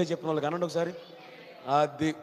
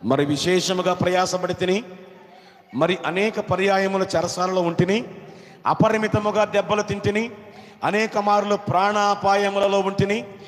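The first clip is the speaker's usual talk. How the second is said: loud, declaimed Bible verses; ringing read-aloud, phrase by phrase; forceful scripture reading; 2 semitones higher, 7 dB louder than usual. Real, not acted. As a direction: proclaim each phrase to the whole church.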